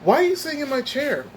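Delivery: sharp, high-pitched mock coworker demanding his chair; coworker's accusing question. Retelling the coworker who claimed his chair, high mock accusation. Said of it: "he came around and was like".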